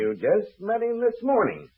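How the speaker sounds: slow, high-pitched disbelieving echo; incredulous. Acted. Official questioning two suspects about their acquaintance, incredulous. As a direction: repeat it back in drawn-out disbelief.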